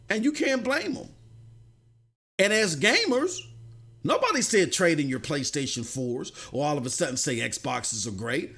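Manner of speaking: normal talking voice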